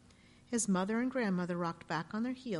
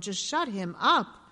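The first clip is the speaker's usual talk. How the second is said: groaning; 7 dB louder, slower than usual. A narrator's reading. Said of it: "he groaned".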